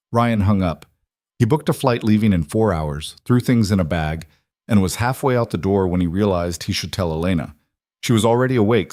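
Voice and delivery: usual speaking voice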